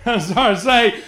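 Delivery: starting to say it through a laugh. Real, not acted. Preacher catching himself mid-joke with the congregation, laughing. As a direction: let the laugh break into the repeated words.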